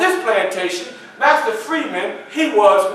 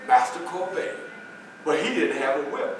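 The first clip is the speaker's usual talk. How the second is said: ordinary delivery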